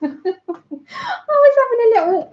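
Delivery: laughing through the words; shaky laugh while talking; nervous laughing. Real, not acted. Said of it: "like, a moment".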